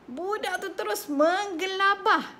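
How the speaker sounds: normal talking voice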